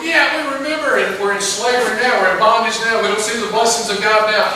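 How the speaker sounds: eager yes, then pressing their need; eager captives. Retold or imitated. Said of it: "And they're going".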